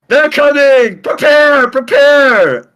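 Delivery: loud, high alarm call; yelling. Acted. Ramonda warning the whole fort, loud and high. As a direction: bellow a warning across the fort. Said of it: "just yelling".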